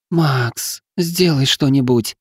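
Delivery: high, slow pained plea; a sick woman calling out for help; moaning plea. Acted. Wife calls again to Max, moaning. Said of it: "снова донеслось от супруги".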